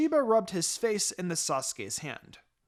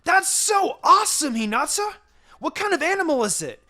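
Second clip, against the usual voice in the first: much higher and louder, rapid-fire eager questions; excited exclaiming; 9 semitones higher, 7 dB louder than usual. A narrator's reading. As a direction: bombard her, bursting with excitement. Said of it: "raced up to her and exclaimed".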